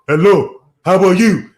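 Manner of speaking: loud greeting in a put-on African accent; mock African accent. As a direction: imitate an African woman's hello, loudly. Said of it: "Why your voice so deep?".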